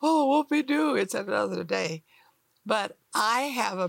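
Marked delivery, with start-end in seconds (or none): 0.0-2.0 sarcastic. Retold or imitated.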